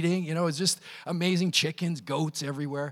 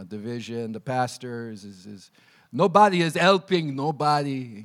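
put-on Russian accent; 6 semitones lower than usual. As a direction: voice Pierre's gloomy warning in a mild Russian accent. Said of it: "that's Russian".

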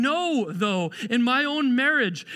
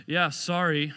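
dismissive apology; 7 semitones lower, slower than usual. Acted. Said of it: "Or, or it's this one".

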